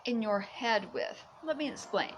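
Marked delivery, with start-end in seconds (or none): none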